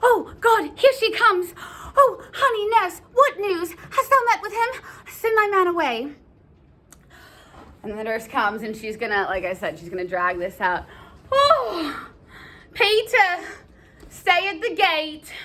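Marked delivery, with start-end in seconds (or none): 0.0-6.1 high, excited rush of relief; eager greeting. Acted. Juliet greets the returning nurse. 11.3-15.3 high, moaned order to a servant; put-upon, theatrical nurse voice; weary nurse. Acted.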